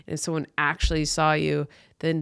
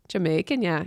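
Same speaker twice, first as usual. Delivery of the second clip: ordinary talk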